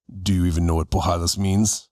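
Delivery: a low, gruff challenge from a tough veteran; a veteran fighter lowers his voice to quiz the newcomer. Retold or imitated. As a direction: growl it down low like an old school hard man. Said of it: "he's just a very gruff guy".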